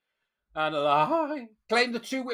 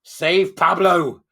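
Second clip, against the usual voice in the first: slow, much louder rallying call; mock protest cry; 10 dB louder, much slower than usual. Host mocking campaigners for the dog, slow loud slogan. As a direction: chant it like a placard slogan.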